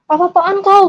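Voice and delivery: high-pitched, outraged challenge; imitated alarmed mother. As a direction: act out a mother confronting him. Mother confronts father holding machete.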